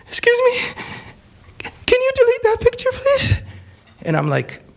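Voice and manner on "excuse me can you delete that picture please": much higher, timid pleading voice; meek young woman. Retold or imitated. Hijabi girl begging the speaker to delete her photo, high timid plea. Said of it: "The hijabi girl that was just screaming".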